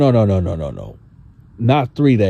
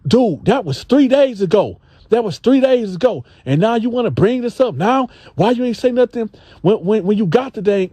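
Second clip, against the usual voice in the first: high, exasperated mock complaint; Gene voiced indignant; 11 semitones higher than usual. Gene telling the dude it was three days ago, high and exasperated. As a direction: play Gene, fed up and pitched high. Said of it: "Gene was like".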